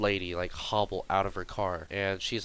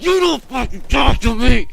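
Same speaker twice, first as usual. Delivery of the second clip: loud, high imitation of a furious woman; angry shouting; 13 semitones higher, 12 dB louder than usual. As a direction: shout him down in mock fury. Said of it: "shouting at him".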